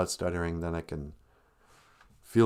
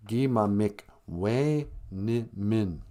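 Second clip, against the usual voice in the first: "gi ma mik we ni min" slowly, split into syllables; much slower than usual. Shown as a demonstration. Teaching listeners an Ojibwe word, syllable by syllable. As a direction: sound out each syllable with small gaps. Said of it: "you should learn it backwards".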